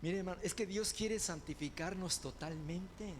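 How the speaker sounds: ordinary talk, nothing marked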